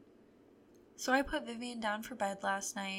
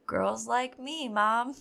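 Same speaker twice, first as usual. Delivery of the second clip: smug boast; 2 semitones higher, 8 dB louder, much slower than usual. Retold or imitated. Son's proud boast.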